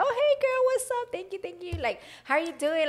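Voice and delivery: the friendly hostess greeting everyone; gushing hi-girl voice for fans; bubbly fan greeting. Retold or imitated. Her Lily switch, greeting fans.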